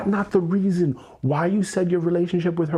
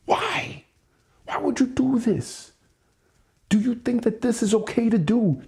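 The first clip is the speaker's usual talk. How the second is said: higher-pitched, exasperated scolding, held back in volume; berating; 5 semitones higher than usual. Retold or imitated. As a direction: berate them in disbelief without yelling. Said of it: "I hear these words".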